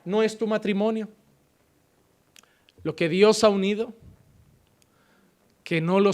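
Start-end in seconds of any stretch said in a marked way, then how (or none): none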